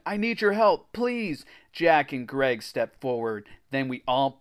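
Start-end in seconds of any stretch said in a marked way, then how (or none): 0.1-1.4 high, pleading moan; moaned plea. A narrator's reading. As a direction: beg for help in a high voice. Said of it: "I moaned".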